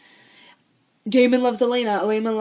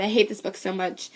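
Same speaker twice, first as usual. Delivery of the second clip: ordinary delivery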